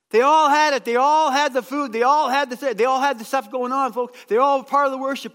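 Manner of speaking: loud, high, pounding preaching. Real, not acted. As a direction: hammer the point home. Preaching climax on "all.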